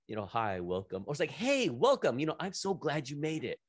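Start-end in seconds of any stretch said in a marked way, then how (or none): 1.3-3.6 warm enthusiastic greeting. Shown as a demonstration. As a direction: greet them like you're thrilled they came. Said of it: "what would you rather hear?".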